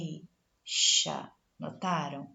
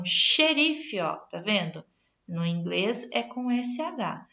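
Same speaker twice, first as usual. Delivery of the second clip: ordinary talk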